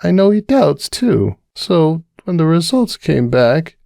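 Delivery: high, tipsy, worried mare voice; Cupcake confiding. Acted. Cupcake confiding her fears to the bartender, high and worried. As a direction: pour out the worry, a little tipsy.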